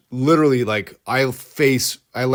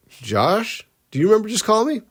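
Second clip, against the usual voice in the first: higher, puzzled mother; alarmed mom; 9 semitones higher than usual. Retold or imitated. Mom noticing her son called twice, high alarmed voice. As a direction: ask carefully, starting to worry.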